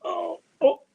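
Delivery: sudden high loud oh oh; startled cry. Real, not acted. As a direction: caught off guard by the cat. Startled by cat.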